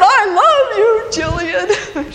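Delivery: high, loud holler to a niece; yelled. Retold or imitated. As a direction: call it out at full voice on a ride. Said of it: "I yelled".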